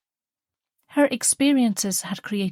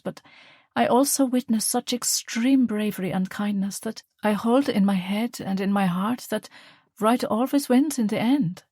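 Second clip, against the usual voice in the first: put-on higher, reflective old mother; earnest mother; 5 semitones higher than usual. Mother reflecting on the war, earnest. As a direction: speak from hard-won conviction.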